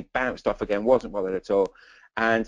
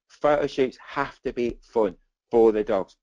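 ordinary talk, nothing marked

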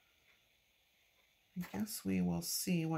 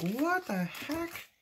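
high, slow, startled exclamation; squeaky disbelief; surprised; 11 semitones higher, 4 dB louder, much slower than usual. Retold or imitated. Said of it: "I'm like".

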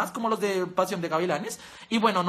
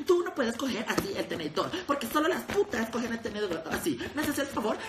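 softer, lightly voiced prim scold, laughing; mock a judgmental woman's comment; hater commenter; 2 semitones higher, 4 dB quieter than usual. Imitating women commenting on Pau Tips' photos, a mild prim scolding. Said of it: "las viejas le comentan como".

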